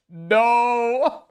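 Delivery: long incredulous wail before cracking up; gleeful disbelief. Real, not acted. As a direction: cry out in delighted disbelief.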